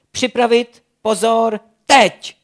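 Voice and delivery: loud, high countdown shout; shouted command. A narrator's reading. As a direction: bark the launch count. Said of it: "vykřikl Lev Blesk".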